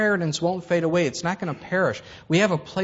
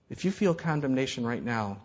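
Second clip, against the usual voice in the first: ordinary talk, nothing marked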